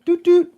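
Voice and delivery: imitating a horn beep at the old man; mock horn toot. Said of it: "I honk my horn".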